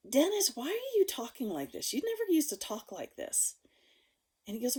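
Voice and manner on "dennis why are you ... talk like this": higher-pitched questioning of a friend; retold puzzled challenge, pitched up; incredulous confronting. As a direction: call him out, voice raised in pitch.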